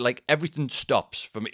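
ordinary talk, nothing marked

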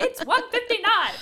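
high, loud alarmed cry at the clock; panicked realisation. Retold or imitated. Friend realising she's late, yelping in panic. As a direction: yelp it like you just saw the time.

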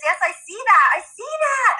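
high encouraging praise; parent praise. Retold or imitated. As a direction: affirm a child warmly. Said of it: "I have to say, my kids".

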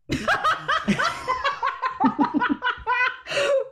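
long, high-pitched burst of giggles; real high laughing fit; helpless laughter. Real, not acted. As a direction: crack up completely, high and sustained. Cracking up at Buffy's accent.

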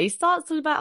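ordinary delivery

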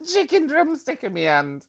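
high laughing through the words. Real, not acted. Caller laughing at her own kimono joke. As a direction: giggle while picturing it.

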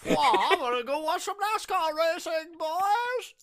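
high-pitched, squeaky mock American; American accent. Shown as a demonstration. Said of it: "quite high pitched".

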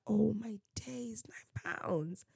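slow, hushed retold disbelief; hushed shock. Speaker recalls seeing the nine pounds price, hushed shock. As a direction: drop to a soft, stunned near-whisper.

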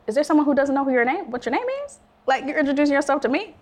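high-pitched, quick incredulous questioning; mystified. Retold or imitated. Common introduces himself to her, mystified reply. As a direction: rattle it off in rising disbelief. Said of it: "I was all mystified".

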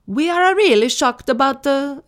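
loud, high, hammed-up Italian accent. Reading the band's statement, in a mock Italian accent. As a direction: read it as a cartoonish Italian. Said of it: "can I do an Italian accent?".

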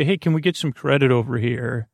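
ordinary delivery

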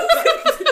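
squealing caffeinated laugh; a jittery high-pitched giggle; giddy high laugh. Real, not acted. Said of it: "Tomé mucho café".

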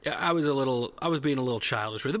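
ordinary delivery

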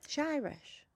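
soft, wobbly Irish accent attempt. Shown as a demonstration. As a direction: try Irish, drifting towards Scottish. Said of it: "No, that's Scottish".

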